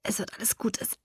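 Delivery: soft, pained, breaking off; forcing words through pain; breathless reassurance through agony; strained in pain. Acted. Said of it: "er brach ab und krümmte sich".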